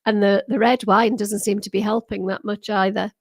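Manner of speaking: ordinary delivery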